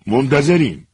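loud, high, demanding. Acted. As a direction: snap it out to hurry him. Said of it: "صدایش رو بالا برد".

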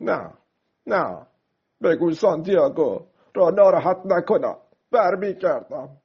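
put-on high-pitched, upset boy's voice; tearful. Boy refusing the café owner's offer, tearful. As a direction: answer as if just done weeping. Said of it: "شروع به گریستن کرد".

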